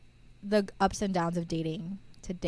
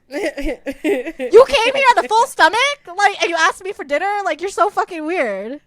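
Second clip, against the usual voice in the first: exasperated rant; 9 semitones higher, 13 dB louder than usual. Retold or imitated. Ranting about the date.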